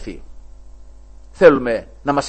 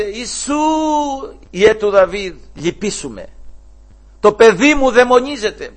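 mock cry for mercy; 8 semitones higher, 5 dB louder than usual. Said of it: "και φώναζε".

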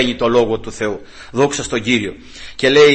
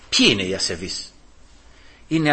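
ordinary talk, nothing marked

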